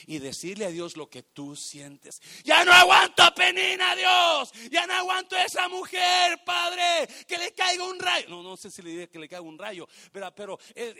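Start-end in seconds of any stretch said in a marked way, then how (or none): 2.4-8.3 high, loud cry of a woman at her limit; desperate shout. Acted. Woman pouring out her soul, shouting her anguish to God.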